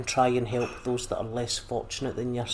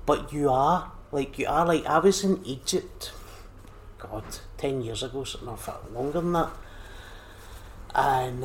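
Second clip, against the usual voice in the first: ordinary delivery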